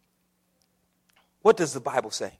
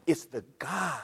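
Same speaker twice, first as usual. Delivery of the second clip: ordinary talk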